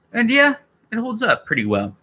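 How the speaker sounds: sing-song it up high, upbeat; high-pitched verdict. Real, not acted.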